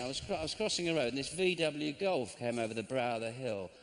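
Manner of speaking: normal talking voice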